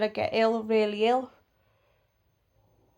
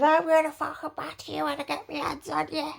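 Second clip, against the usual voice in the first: higher put-on voice for the neighbour; mocking threat; 5 semitones higher than usual. Neighbour threatening to batter her, high mocking imitation.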